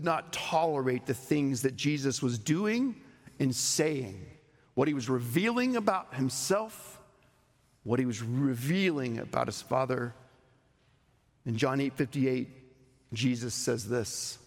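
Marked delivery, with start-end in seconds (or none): none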